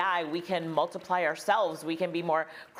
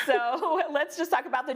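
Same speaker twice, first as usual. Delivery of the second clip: laughing; 9 semitones higher than usual. Real, not acted. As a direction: still laughing while moving on. Host still laughing.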